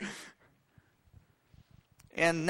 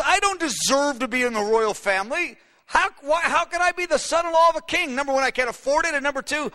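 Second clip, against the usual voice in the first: high-pitched, incredulous protest of unworthiness; David's humility; 5 semitones higher than usual. Acted. Young man refusing a royal marriage, humbly protesting. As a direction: protest earnestly that you are not worthy. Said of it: "his humility".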